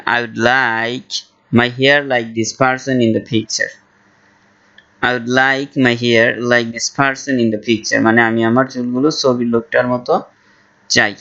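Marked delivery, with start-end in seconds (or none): 0.1-8.0 very slow, careful English; slow model phrase. Shown as a demonstration. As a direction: say it slowly for learners. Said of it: "তখন বলবেন". (ধীরে ধীরে স্পষ্ট উচ্চারণে)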